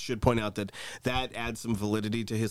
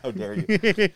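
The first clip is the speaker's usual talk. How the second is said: mock outrage; 7 semitones higher, 6 dB louder, slower than usual. Mock offense at a joke.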